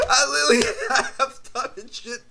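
real laughing talk. Real, not acted. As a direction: laugh while saying it. Laughing at the memory.

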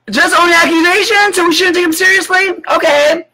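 mock her reasoning in a shrill sarcastic pitch. Real, not acted. Vlogger mocking the hosts' excuse, high sarcastic voice.

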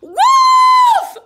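very high, loud scream; terrified cry. Acted. Boy finally meets the real wolf, very high loud scream. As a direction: scream it for your life. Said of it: "cried Willie".

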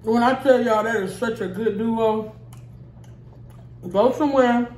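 eating between words; talking while chewing. Real, not acted. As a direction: mouth partly full. Seen 5: Pursed, working lips throughout; ate just before; next forkful arrives 4.2s.

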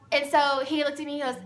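ordinary delivery

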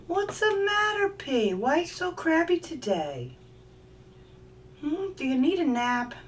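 high sing-song coo at the bird. Real, not acted. Cooing at pet bird.

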